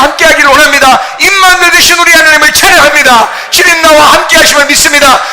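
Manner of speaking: loud, high, impassioned crying out to God; shouted prayer. Real, not acted. Pastor crying out in prayer over music, loud and high. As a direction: pray at full force, pleading.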